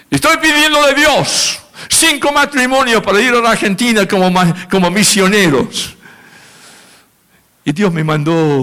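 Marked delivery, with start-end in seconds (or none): 0.1-6.0 higher, lightly voiced woman pleading; missionary woman's appeal. Missionary woman asking the church for couples, in a lightly voiced high plea. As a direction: give her a small but firm plea.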